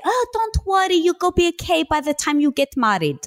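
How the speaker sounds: put-on thick Greek accent, high and soothing; a Greek grandmother comforting in her accent; Greek grandma accent. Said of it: "in her thick Greek accent".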